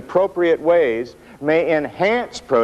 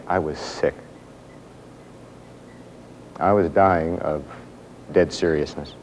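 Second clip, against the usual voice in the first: choked up; 6 semitones lower, 5 dB quieter than usual. Real, not acted. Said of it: "sorry to get too emotional".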